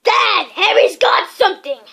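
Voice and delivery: put-on loud, high whiny kid voice; tattling child. A narrator's reading. Dudley tells on Harry to his father, loud and whiny. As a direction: shout it like a spoilt boy telling on someone.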